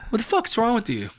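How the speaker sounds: high indignant bro voice; mock angry friend. Offended friend demanding answers, high mock anger.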